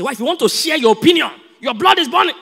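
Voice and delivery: high, loud heated shout; angry outburst. Acted. Husband shouts at arguing wife, hammed-up anger. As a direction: flare up at the wife.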